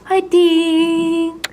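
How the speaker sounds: long, high, loud cheer; excited cheer. Real, not acted. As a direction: cheer it out, stretched. Cheering the team on.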